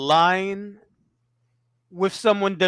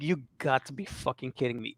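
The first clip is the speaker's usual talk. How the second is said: quick, much softer aside to oneself; retold disbelief; 4 semitones lower, 10 dB quieter, faster than usual. Storyteller recalling a close look at him, hushed disbelief. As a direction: re-enact disbelief, low-volume and quick. Recounting his disbelief at the sighting.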